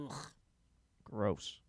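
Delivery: usual speaking voice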